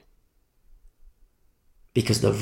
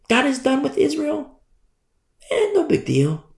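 high, mocking voice of the false teachers; 10 semitones higher than usual. Preacher mocking false teachers to the church, high and sneering. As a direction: sneer out their easy lies, pitched up. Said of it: "What is it that they teach?".

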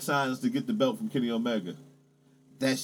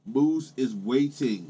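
slower drawn tease; 3 semitones lower, much slower than usual. Pretend.

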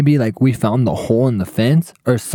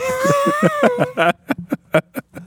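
very high, squealing laugh; real helpless laughter. Real, not acted. Storyteller cracking up before the gross reveal, helpless high laugh. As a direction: break down laughing, unable to go on.

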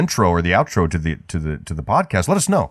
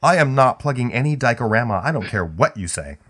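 ordinary talk, nothing marked

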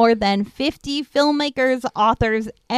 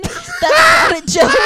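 high, loud, drawn-out wail through laughter; laughing protest; 5 dB louder than usual. Real, not acted. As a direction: insist on it while crying with laughter. Insisting amid laughing fit.

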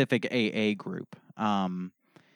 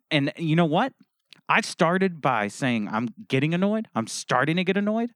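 higher and higher, getting worked up; rising annoyance; 7 semitones higher, 3 dB louder than usual. Acted. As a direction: let the irritation climb line by line. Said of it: "you're annoyed".

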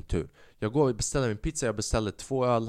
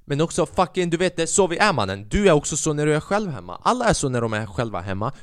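normal talking voice

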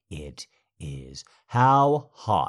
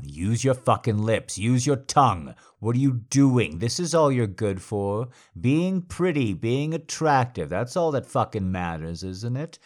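loud, higher, harsh berating; angry berating; 5 semitones higher, 6 dB louder than usual. Acted. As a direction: lash out loudly. Dominant partner berates loudly.

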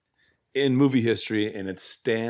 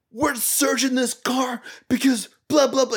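retold scream; 14 semitones higher than usual. Retelling Utah screaming in his face, mock high yell. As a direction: imitate screaming without real volume. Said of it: "he just screams in his face".

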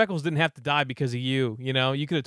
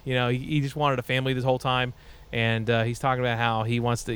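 usual speaking voice

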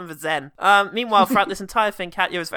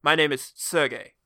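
hopeless spy; 3 semitones lower than usual. Retold or imitated. Keanu introduces himself as Sergey, a hopeless spy.

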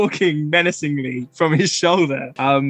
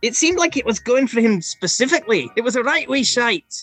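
higher Scottish lad, hurt over his brother; upset Scottish kid; 7 semitones higher than usual. Acted. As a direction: blame the serpent without blaming them. GM voices the kid.

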